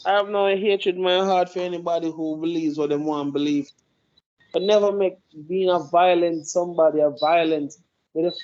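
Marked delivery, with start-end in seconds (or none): none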